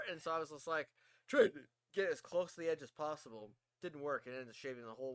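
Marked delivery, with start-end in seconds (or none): none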